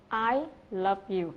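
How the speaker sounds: slow "I love you" with "I" stressed and falling; "I" strongly stressed, "love you" light. Shown as a demonstration. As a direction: put all the weight on "I". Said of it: "anh ta sẽ nhấn vào cái từ "I".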